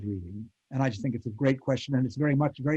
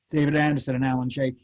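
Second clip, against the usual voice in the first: ordinary delivery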